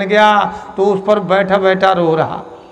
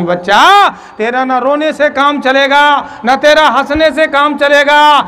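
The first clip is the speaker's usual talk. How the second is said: higher, louder teaching; emphatic call; 8 semitones higher, 6 dB louder than usual. Acted. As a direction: press the lesson firmly. Holy man instructs him.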